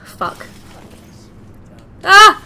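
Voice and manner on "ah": loud, high panicked shouts; panicked yelling. Real, not acted. Trapped under fire, panicked screaming.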